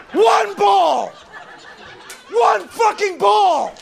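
yelling at the latte guy in a high screech; high mocking shout. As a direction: screech the insult, stretch the last one. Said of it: "escalating comic shout".

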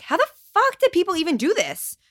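a mother at the end of her rope, half-laughing at herself; exasperated outburst. Retold or imitated. Retelling her frustration filming baby.

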